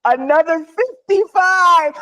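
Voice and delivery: retelling the banker's big news in awe; awed retelling. Retold or imitated. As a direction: savour each word in amazement.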